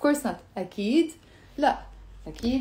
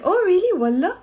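high, loud "Oh, really?", rising والله; interested surprise; 8 semitones higher, 5 dB louder, faster than usual. Shown as a demonstration. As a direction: react to news with a questioning lift. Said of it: "You see the tone in my, of my voice?".

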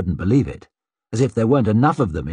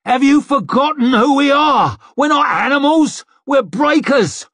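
high, loud, outraged shouting; shouted rebuke; 5 dB louder than usual. A narrator's reading. Curbman to his rioting fellow breakers, shouted rebuke. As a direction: bellow it to shame the whole crowd.

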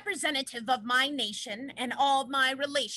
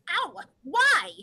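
sudden ow, then a louder, higher why; pained surprise; 5 semitones higher, 4 dB louder than usual. Acted. Condor yelps at man plucking his feathers, pained surprise. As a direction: yelp as the feather is pulled.

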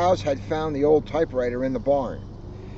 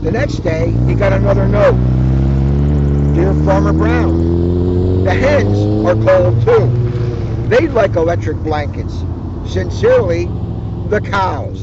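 deep cow voice; 11 semitones lower, 7 dB louder than usual. Acted. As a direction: read it like a big bass cow. Deep far voice reading the note.

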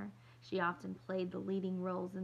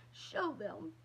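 commanding. Acted. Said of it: "she commanded".

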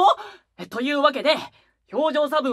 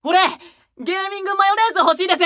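fast, louder, higher eager request; eager pitch; 5 semitones higher, 4 dB louder, faster than usual. Acted. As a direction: blurt out the wild idea. Excited silly proposal. (興奮して早口で)

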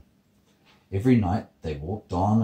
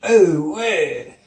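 high, very loud outraged roar; big storybook roar, pitched high; roaring sun; 10 semitones higher, 9 dB louder, slower than usual. Acted. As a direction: roar in startled protest. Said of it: "roared the sun".

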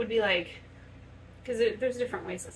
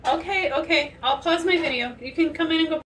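calling out; 7 semitones higher, 7 dB louder than usual. Real, not acted. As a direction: call it over the door. Answering kid at the door.

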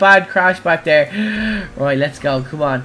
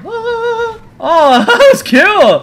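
high, loud laughing cheer; laughing delight; 14 semitones higher, 6 dB louder, much slower than usual. Real, not acted. Gamer laughing high and loud after jumping the barrier.

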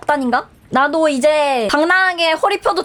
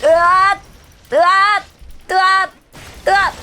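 loud, higher yelps of alarm; startled cries; 5 semitones higher, 3 dB louder than usual. Real, not acted. Gamer yelps loudly mid-fight, startled. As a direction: yelp as if hit.

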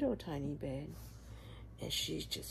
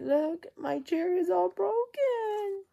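put-on sad baby bear; 13 semitones higher, 3 dB louder, slower than usual. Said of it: "he's so sad".

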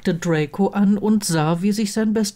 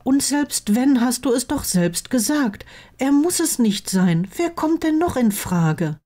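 higher-pitched voice for the reproving friend; indignant best friend; 8 semitones higher than usual. Acted. Friend insisting contraception is not one person's job, indignant. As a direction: argue the point in a high, insistent voice.

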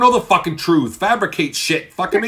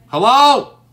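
shouting hello into the silent stream, much louder and higher; loud call; 7 semitones higher, 10 dB louder than usual. Real, not acted. Streamer yelling hello to viewers who went silent, loud call. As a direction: call out hard to find the missing viewers.

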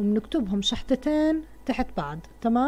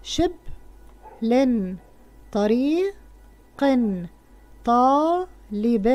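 each word drawn out very slowly; slow reading; much slower than usual. Shown as a demonstration. Teacher reading the words to pupils, very slowly stretched. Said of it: "أرسم تنوين الكسر عند آخر كلمة".

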